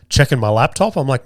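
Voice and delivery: ordinary talk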